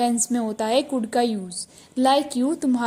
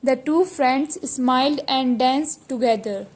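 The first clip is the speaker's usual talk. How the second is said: slow dictation reading; much slower than usual. Shown as a demonstration. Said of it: "teacher reads the sentence slowly".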